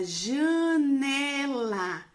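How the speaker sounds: "janela" stretched slowly and loudly; drawn-out syllables. Shown as a demonstration. Teacher introducing a word to separate, "janela" loud and drawn out. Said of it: "Eu vou separar".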